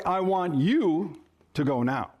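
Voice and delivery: single out "you", pause after it; stress on you. Shown as a demonstration. Spouse showing another nuance, heavy stress on "you". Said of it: "might hear in a certain way".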